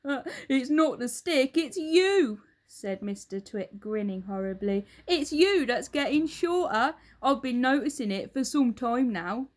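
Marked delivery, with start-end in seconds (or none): 0.0-2.4 put-on a louder, gloating old husband; Mr Twit. Husband taunts his wife, loud and gloating. 5.1-9.5 put-on a louder, gloating old husband; Mr Twit. Husband taunts his wife, loud and gloating.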